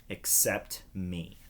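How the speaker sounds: usual speaking voice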